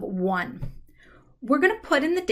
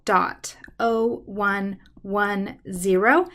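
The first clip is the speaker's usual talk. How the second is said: slow keying dictation; much slower than usual. Shown as a demonstration. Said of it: "so to put that in".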